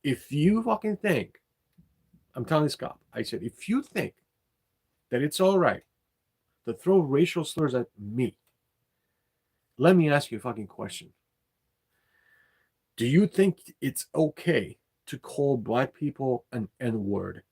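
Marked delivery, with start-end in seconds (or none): 0.0-1.4 high, indignant challenge to a cop; heated retold comeback, pushed high; retold upset confrontation. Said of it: "that upset me so much". 3.4-17.4 heated, emphatic retold demand; retold upset confrontation. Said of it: "that upset me so much".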